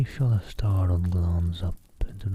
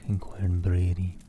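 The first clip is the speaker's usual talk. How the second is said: usual speaking voice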